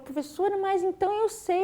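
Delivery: a hint of an excited child, pitch rising high; pupil's surprised delight. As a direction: imitate the boy realising he can read.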